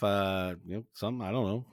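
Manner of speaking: ordinary talk, nothing marked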